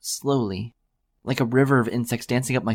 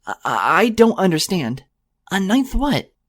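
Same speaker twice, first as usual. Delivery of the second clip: higher, slow, bewildered questions; ask, baffled and shaken; confused mare; 8 semitones higher, 5 dB louder, slower than usual. A narrator's reading. Mare asks the yelling unicorn, high bewildered voice.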